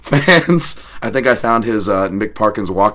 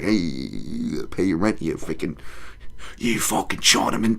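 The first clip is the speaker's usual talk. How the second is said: mock English tough guy, slow start; lightly growled threat in a put-on English accent; 4 semitones lower, 6 dB quieter than usual. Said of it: "YouTube is a racist English guy".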